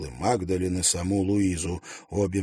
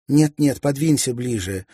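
firm and raised, insisting; louder and higher, brooking no refusal; decisive; 6 semitones higher, 9 dB louder than usual. A narrator's reading. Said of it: "решительно сказала Магдален". (решительно, громче и выше)

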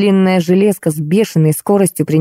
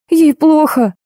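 high-pitched alarmed squeal; narrated yelp, pitched way up; acted squeal; 10 semitones higher, slower than usual. A narrator's reading. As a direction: squeal it in a panicky, put-on voice. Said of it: "взвизгнул Кеша".

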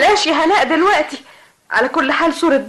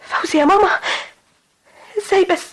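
shocked disbelief; 6 semitones higher than usual. Acted. Daughter shocked by marriage news.

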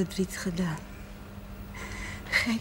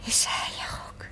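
whisper; 6 dB quieter than usual. Acted. Mother drifting off to sleep.

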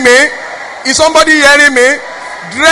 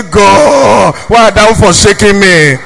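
low, slow, louder mock cry to God; husband's mock lament; 4 semitones lower, 4 dB louder, much slower than usual. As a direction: ham up the husband's despairing plea. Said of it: "Your husband look at you and say".

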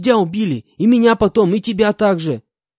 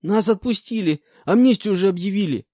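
put-on frightened young con; 6 semitones higher, 5 dB louder than usual. Terrified prisoner answers interrogation.

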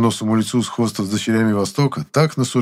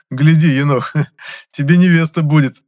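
louder, higher, laughing tease; 6 semitones higher, 6 dB louder than usual. Acted. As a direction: chuckle while teasing a friend. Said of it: "хохотнул я".

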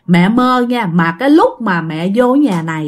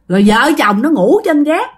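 usual speaking voice

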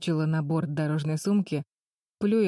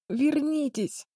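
hoarse cry; 10 semitones higher, much slower than usual. Acted. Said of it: "прохрипела девочка".

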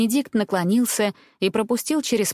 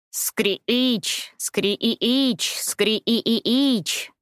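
mocking bird screech; 3 semitones higher, much slower than usual. A narrator's reading. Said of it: "насмешливо вскрикивать и верещать".

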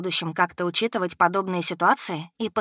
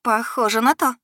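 smirking; 5 semitones higher, 4 dB louder than usual. A narrator's reading. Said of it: "усмехнулась".

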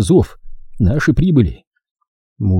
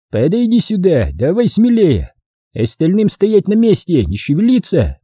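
lightly voiced commander, pitched up; командир отряда; 8 semitones higher than usual. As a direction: give orders to the crowd. Figure of the story. (командирский приказ повышенным голосом)

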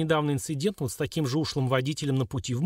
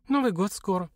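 musing, higher-pitched remark; thoughtful, drifting aside; pensive; 7 semitones higher than usual. A narrator's reading. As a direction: say it out of the blue, wistfully. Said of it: "задумчиво промолвила она".

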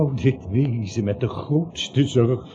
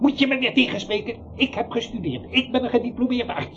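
geïrriteerd; 9 semitones higher, much faster than usual. Acted. Dokter geïrriteerd tegengesproken.

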